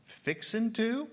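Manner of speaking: slow, high-pitched, rising echo; incredulous query. Retold or imitated. Baffled Canadians echoing the Southern phrase, incredulous. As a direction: draw it out as a puzzled question.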